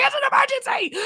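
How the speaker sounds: high-pitched comic protest; mock yelling. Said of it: "it's just me yelling to myself".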